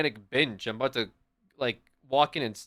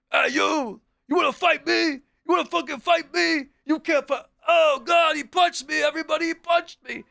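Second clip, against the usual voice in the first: mock aggressive prankster; 13 semitones higher, 5 dB louder than usual. Prank guy picking fake fight.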